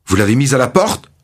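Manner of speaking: high-pitched indignant question, mildly shouted; angry cry. A narrator's reading. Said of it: "crie-t-il".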